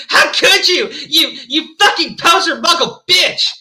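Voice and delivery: high, loud, anguished yelling; angry shout. A narrator's reading. Draco berating Ebony for hiding it, shouting angrily. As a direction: hurl it as a hammed-up accusation. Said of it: "he shouted".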